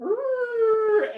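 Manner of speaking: growl sound. Acted. GM voices creature sound.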